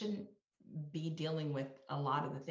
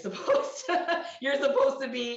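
talking through a laugh, higher and louder; 9 semitones higher, 9 dB louder than usual. Real, not acted. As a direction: break into a real laugh mid-sentence. Laughs mid-sentence at stereotype contradiction.

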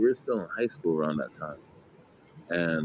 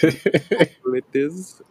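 higher, halting mock Kazakh accent; Borat accent; 9 semitones higher, 5 dB louder than usual. Pupil winding up teachers over homework in a mock Kazakh accent. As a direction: excuse yourself in a high, hammy Kazakh accent. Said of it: "I used to do it in class".

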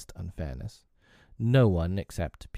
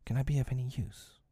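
softer, a little higher and shy; timidly; 5 semitones higher, 6 dB quieter than usual. Acted. The kite tail offering to help, timid and soft. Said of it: "as if saying timidly".

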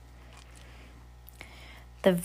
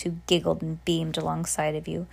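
usual speaking voice

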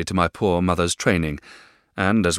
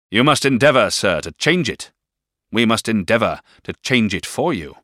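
louder, forceful demand; stern command; 6 semitones higher, 7 dB louder than usual. A narrator's reading. Stepfather orders the boy to change, forceful. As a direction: press each word home. Mr Murdstone voiced.

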